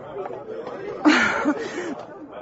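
nervous laugh. Acted. Helen brushes off Carpenter's question.

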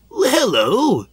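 very high, loud, cheeky hello; grinning greeting. A narrator's reading. Toad surprising Dudley in the siding, grinning. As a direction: swoop down from a high, gleeful start. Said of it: "grinned Toad".

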